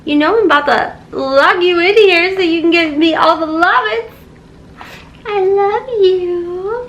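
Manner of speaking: high, sing-song pet talk. Real, not acted. Baby talk to her cat.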